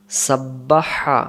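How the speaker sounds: each syllable stretched for learners; slow model reading. Shown as a demonstration. Teacher demonstrating to beginners, slow. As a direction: draw the word out slowly. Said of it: "teacher models slow reading".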